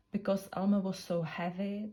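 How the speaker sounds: ordinary delivery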